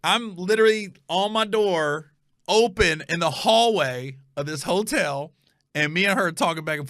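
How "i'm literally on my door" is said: normal talking voice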